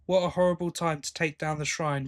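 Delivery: ordinary talk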